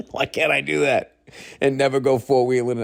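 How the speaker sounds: real laughter breaking into the question; giggling through his own argument; laughing. Real, not acted. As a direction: ask it mid-laugh.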